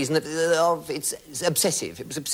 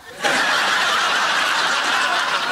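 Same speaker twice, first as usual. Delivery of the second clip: laughing; 6 dB louder than usual. Real, not acted. Big laugh at punchline.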